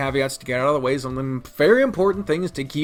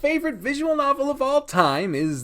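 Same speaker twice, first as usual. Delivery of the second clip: mock grand reveal; 7 semitones higher than usual. Announcing his most hated game.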